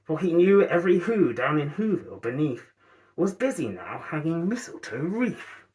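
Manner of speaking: ordinary delivery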